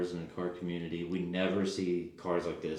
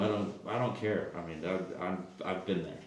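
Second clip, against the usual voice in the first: usual speaking voice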